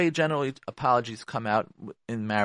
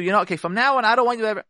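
mock demanding spouse; 7 semitones higher, 5 dB louder, much faster than usual. Acted spouse laying down rules.

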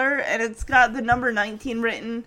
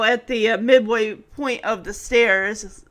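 ordinary delivery

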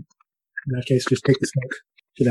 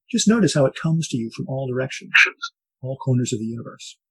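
soft spa whisper; 6 dB quieter than usual. Acted. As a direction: ease them gently into calm. Leads a guided breathing meditation.

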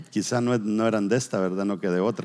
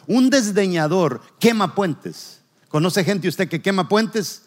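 higher, louder preaching at full force; 4 semitones higher, 4 dB louder than usual. Acted. Pastor warns his congregation about burning bridges, forceful preaching.

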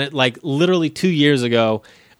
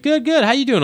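mock-chirpy table of diners, much higher; chirpy customers; 12 semitones higher than usual. Cheerful diners answering the waiter, mock-chirpy and much higher. Customers voiced in retold story.

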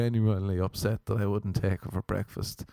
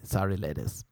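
ordinary talk

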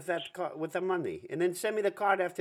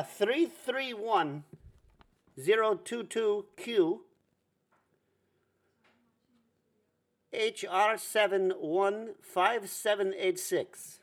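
dictating slowly; slower than usual. Acted. Reading card number to caller.

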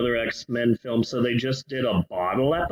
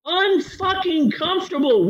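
laughing exasperated rant; 11 semitones higher than usual. Acted. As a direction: yell at her through laughter. Ranting at character, laughing.

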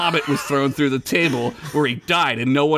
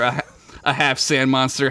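ordinary delivery

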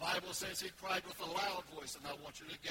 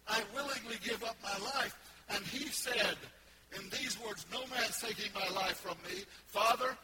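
loud, high, shouted proclamation; full-force preaching; 6 semitones higher, 8 dB louder than usual. Real, not acted. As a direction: preach with all your voice. Preacher voicing Jesus, full force.

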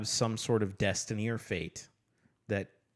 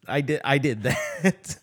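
higher, louder, breaking into laughter; laughing quote; 5 semitones higher, 6 dB louder than usual. Retold or imitated. Host quoting the book's narrator to co-host, laughing. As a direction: quote it while cracking up.

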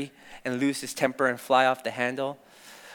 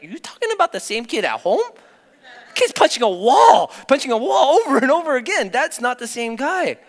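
high, loud imitation of a disbelieving mother; incredulous mom; 10 semitones higher, 8 dB louder than usual. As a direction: protest in mock disbelief, voice pitched up. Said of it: "She's like".

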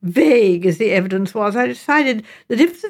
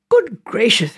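mild exclamation; 2 semitones higher, slower than usual. A narrator's reading. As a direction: exclaim it with a touch of surprise. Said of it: "I exclaimed".

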